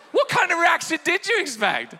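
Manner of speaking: high, loud comic disbelief; mock incredulity. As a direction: fire back in high-pitched mock astonishment. Joking retort to the quoted man.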